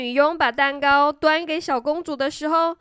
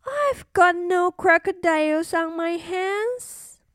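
cartoon princess; 6 semitones higher than usual. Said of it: "said the little princess".

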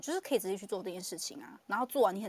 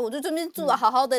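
higher and louder, aggrieved; raised, put-upon voice; mock indignant complaint; 6 semitones higher, 8 dB louder than usual. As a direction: protest like a stubborn parent. Acting out the parent's complaint.

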